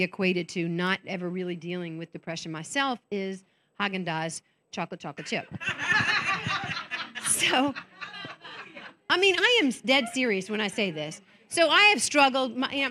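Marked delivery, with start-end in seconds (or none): 5.5-7.2 a real laugh that falls away at the end; light laugh after a punchline; burst of laughter. Real, not acted. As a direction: laugh at your own joke. Laughs at her own joke.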